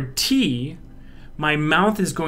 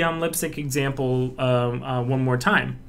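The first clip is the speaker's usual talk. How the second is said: ordinary talk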